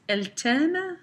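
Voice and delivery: "t'aime" lifted high as a question; rising intonation. Shown as a demonstration. Teacher reads out the sister's question, rising intonation. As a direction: make "Elle t'aime?" a question by raising the voice at the end. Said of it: "my intonation rises with the question".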